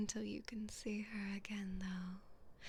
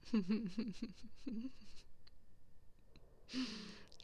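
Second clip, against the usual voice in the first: mocking laugh. Noblewoman laughing at her prisoner's reaction, mocking. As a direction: laugh down at the helpless peasant.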